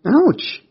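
loud, high "ouch"; pained yelp. Acted. Rex bonks his head scrambling from under the bed, pained high yelp.

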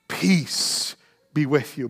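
slow, soft peace; Jesus speaking peace, hushed and slow; gentle blessing. Acted. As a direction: breathe calm over a frightened room. Jesus greeting frightened disciples.

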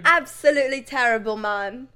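emphatic put-on British accent; loud British accent, easing at the end. Shown as a demonstration. Judging a film character, in a put-on British accent. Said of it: "here's my British one".

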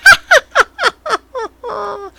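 very high puppet giggle; Elmo. Acted. Imagining a laugh heard at night, piercing puppet giggle. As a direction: giggle like a creepy children's puppet. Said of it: "if I hear fucking Elmo laughing".

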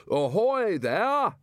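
Ridcully calls out across water, drawn out; shouted hail. A narrator's reading. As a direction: hail a distant swimmer. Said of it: "shouted Ridcully".

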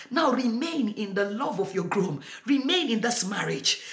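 normal talking voice

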